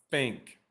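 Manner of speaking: "Think" said as "fink", teeth on lip, no tongue; rabbit face th demo. Shown as a demonstration. Teacher demonstrating the teeth-on-lip trick on "Think". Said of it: "make a rabbit face".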